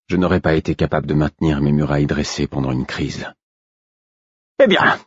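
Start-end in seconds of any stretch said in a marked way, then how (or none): 4.6-5.0 high, loud petulant command; disappointed, sulky. A narrator's reading. Royal ordering the prisoner taken back, disappointed and sulky. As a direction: snap out the order in a royal sulk. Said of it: "déçu et boudeur".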